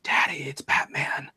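put-on hushed child's whisper; little kid voice. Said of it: "I hear this little voice go".

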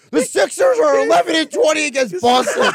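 high-pitched teasing impression of a griping co-host; mock Billy. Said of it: "Billy will still be like".

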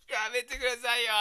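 breathless silent laugh then high plea. Shown as a demonstration. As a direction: laugh too hard to voice. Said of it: "片方の手で口を隠すの".

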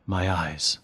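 understated sulky mumble; sulking. A narrator's reading. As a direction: trail it out sullenly and quietly. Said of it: "she sulked".

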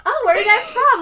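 high, bright deflecting question; change the subject too brightly; high overly chirpy question; fake cheerful.

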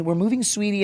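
light imitation of a mom; mom's voice. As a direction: gently play the mother. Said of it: "my mom would say".

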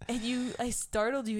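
normal talking voice

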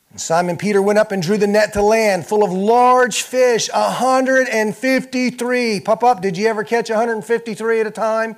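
loud, high, driving sermon delivery, pressing every phrase home; full-force preaching. Acted. Preacher stirring up the congregation, full-force preaching.

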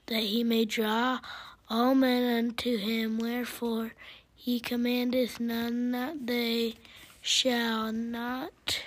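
ordinary talk, nothing marked

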